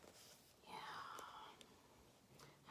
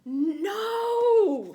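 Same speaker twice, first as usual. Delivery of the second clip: high, loud wailing no; protesting cry; 11 semitones higher, 15 dB louder than usual. Acted. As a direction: cry it out like an upset child. Sophie protests the grab.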